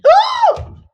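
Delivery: a loud, high mock gasp of delight; hammed-up gasp. Woman hears her date is a pediatrician, hammed-up loud gasp. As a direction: gasp like it's too good to be true.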